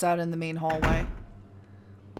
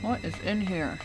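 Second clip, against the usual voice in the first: talking around a pen; words muffled by a pen; pen in teeth; 6 dB quieter than usual. Real, not acted. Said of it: "I got a pen in my mouth".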